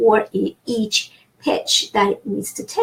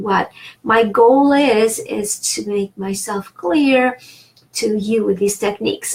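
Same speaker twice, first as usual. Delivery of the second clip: normal talking voice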